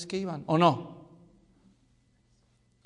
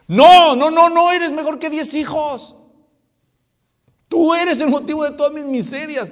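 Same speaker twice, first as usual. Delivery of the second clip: high, very loud angry outburst; exploding in hammed-up rage; furious shout; 12 semitones higher, 15 dB louder than usual. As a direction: yell it back at the husband. Acts Ana's imagined angry answer.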